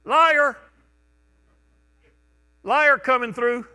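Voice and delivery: loud, high cry of one's own sins; mock announcement. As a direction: call out your shame to society. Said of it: "if we had to do that today".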